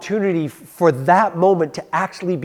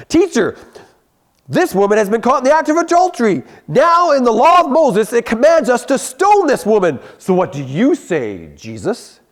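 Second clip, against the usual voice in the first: higher, louder, fast accusing Pharisee; 9 semitones higher, 6 dB louder than usual. Acted. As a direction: push the accusation like a smug prosecutor. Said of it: "That's my Pharisee voice".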